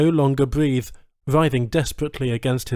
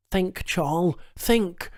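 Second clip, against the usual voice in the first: higher, strained self-urging; urging himself; 6 semitones higher, slower than usual. A narrator's reading. Charles urging himself to think, strained. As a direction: push your foggy mind to work. Dazed hero urges himself.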